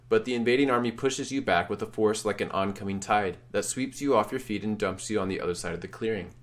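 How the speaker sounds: ordinary talk, nothing marked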